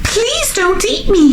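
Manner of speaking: high, pleading squeak; squeaky little goat begging; squealing baby goat. A narrator's reading. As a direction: plead like a tiny frightened goat. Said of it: "he squealed".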